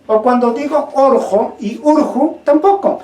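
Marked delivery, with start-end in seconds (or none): 1.0-1.6 "orjo" with o vowels; O variant. Shown as a demonstration. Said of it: "que la O y la U pertenece a un solo fonema". 1.8-2.4 "urju" with u vowels; U variant. Shown as a demonstration. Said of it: "que la O y la U pertenece a un solo fonema".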